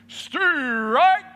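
sudden high, loud umpire call; umpire's scream. Retold or imitated. Umpire calling the final strike, a loud high scream.

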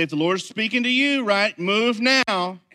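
rally the congregation; pastor exhorting. Retold or imitated. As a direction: pastor urging the church to respond. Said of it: "I say".

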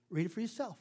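ordinary talk, nothing marked

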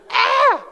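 loud laughing shriek, high and startled; mock little-girl scream. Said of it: "I was like a little girl".

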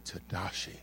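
low, quiet, somber pastor. Retold or imitated. A hint of the pastor calling a boy over, quiet and somber. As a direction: call the name low and solemn. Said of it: "really low in voice, somber".

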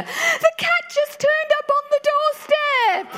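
very high, drawn-out excited squeal; imitated excited neighbour. Neighbour phones about the found cat, imitated excited squeal. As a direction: squeal the good news as the overjoyed neighbour.

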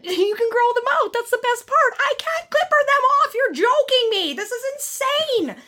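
high, disbelieving rant; high-pitched exasperated outburst ending in laughter; losing it. Retold or imitated. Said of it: "Just lost it".

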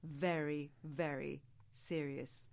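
slow, low and weighty; serious, slowed-down demo. Shown as a demonstration. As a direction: sound grave and credible. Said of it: "land your message sounding very, very serious".

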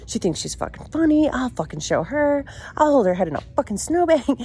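high-pitched retold angry grumbling; bitching mode. Angry daughter plotting revenge on mom, high mock ranting. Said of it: "full on bitching mode".

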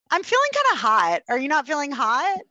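put-on high, coy girly complaint; hint sweetly that you're too hot; good time girl voice. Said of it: "The third is".

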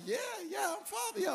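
very high, mock-eager reply; eager squeaky agreement. Pastor answering the lady who calls him Father, squeaky agreement. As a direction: jump up into a comic falsetto.

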